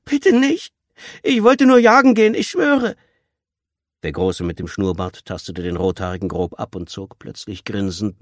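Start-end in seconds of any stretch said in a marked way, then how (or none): 0.0-3.0 very high, loud, desperate begging; frantic cry for mercy; panicked pleading. A narrator's reading. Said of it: "Das Flehen des Jungen wurde panisch".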